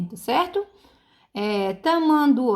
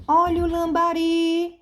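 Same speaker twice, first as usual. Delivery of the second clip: high, sustained hawker's cry; street vendor's call; 7 semitones higher than usual. Shown as a demonstration. Teacher selling the word to find its stress, vendor's call. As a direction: sell the word, holding the final RI long. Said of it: "é você vender a palavra".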